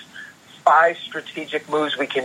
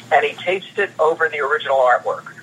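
ordinary delivery

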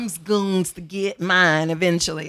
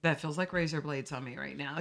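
ordinary talk, nothing marked

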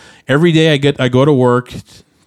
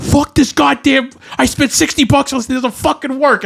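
high-pitched, exasperated cursing rant; furious gamer tirade, pitched high; retold angry outburst. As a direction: re-enact losing it at a broken game, voice jumping way up. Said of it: "I don't think I've ever been that angry".

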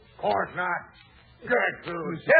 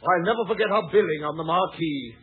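ordinary delivery

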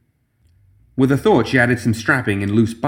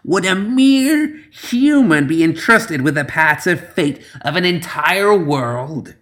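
angry witch; 7 semitones higher, slower than usual. Acted. Witch continues in anger.

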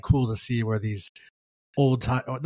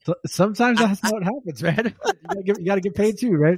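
teasing; 9 semitones higher, 3 dB louder than usual. Acted. Teasing, high voice.

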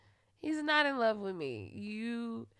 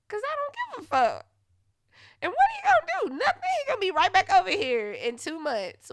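loud, much higher mocking defiance; defiant scoff; 10 semitones higher, 9 dB louder than usual. Real, not acted. Mocking the ex who always returns, defiant. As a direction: taunt him, you don't care.

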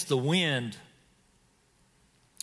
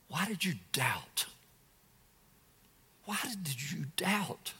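hushed rebuke; 9 dB quieter than usual. Acted. Jesus gently chiding Peter after catching him, hushed to a whisper. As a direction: let it fall away to a whisper.

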